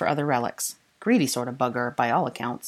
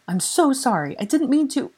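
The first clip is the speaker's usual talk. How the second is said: higher, fluttering apology; anxious; 10 semitones higher, 3 dB louder than usual. Acted. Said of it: "she fluttered above him anxiously".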